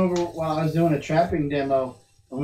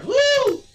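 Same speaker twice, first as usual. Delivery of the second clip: high-pitched startled "woo"; sudden high held whoop; imitated whoop; 4 dB louder than usual. Retelling a fishing tumble, imitated high whoop. Imitating her cry as she fell.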